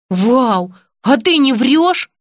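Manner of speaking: high, slow excited disbelief; can't believe the luck; amazed. Acted.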